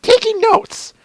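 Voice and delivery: very high, slow, building to a shout; eager student shouting her resolve; Twilight voice. Acted. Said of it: "that was the first thing I thought of was that meme".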